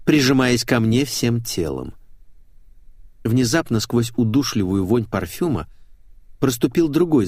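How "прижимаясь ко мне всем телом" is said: plain reading voice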